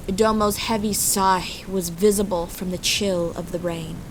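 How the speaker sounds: ordinary delivery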